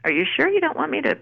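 teasing take on the agent's doubt; doubtful agent. Retold or imitated. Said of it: "And she said".

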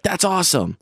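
high, lightly acted fan gushing; a lightly mimicked Rockfest fan calling it awesome; mock enthusiasm. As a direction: imitate a fan's delight. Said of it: "many people would be like".

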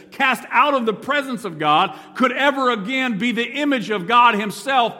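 loud, rising pulpit preaching; preaching at full force. Acted. Preacher to congregation, loud and rising. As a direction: drive the point home to the church.

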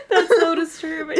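squealing with laughter; helpless high laughter; laughing. Real, not acted. Both laughing hard.

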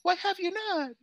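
mock outrage. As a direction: pretend to be shocked. Mock scolding, repeated higher.

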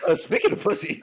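high, loud words through laughter; laughing. Real, not acted. Co-host cracking up at an awkward segue, laughing. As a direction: crack up while echoing it back.

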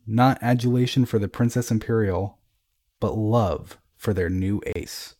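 plain reading voice

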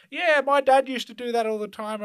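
high, loud imitation of an old lady; lovely old woman. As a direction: play a chatty old woman reminiscing. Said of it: "this old chick was so lovely".

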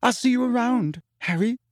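tearful, dramatic goodbye; dramatically. A narrator's reading. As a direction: make an exit line of it. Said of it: "she said dramatically".

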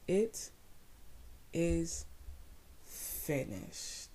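each word set apart, fading to a whisper; slow dramatic pause. Acted. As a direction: let the words drop one by one.

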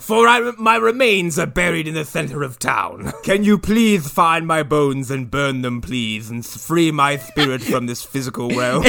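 hammed-up pleading spirit with lisp; ghostly butler. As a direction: plead theatrically as a trapped ghost. Riffing as dead butler's ghost.

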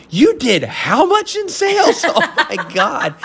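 high, slow, disbelieving squeak; stunned by her sales figure; astonished. Retold or imitated. Reliving the shock at his girlfriend's sales, astonished.

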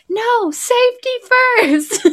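put-on very high, prissy little-kid voice; a mother mocking her careful son, high and prim; imitating cautious son. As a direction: squeak it out like a fussy careful child. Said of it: "here's my kid who's like".

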